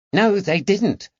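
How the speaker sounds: put-on high, eager boy's voice; a lad bursting to tell his story. A narrator's reading. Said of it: "Eddie gabbled".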